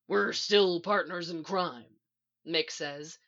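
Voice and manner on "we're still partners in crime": slow, gruff rasp; harsh but tender reassurance; low, harsh voice. A narrator's reading. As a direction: reassure in a rough voice. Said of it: "His voice is low and harsh".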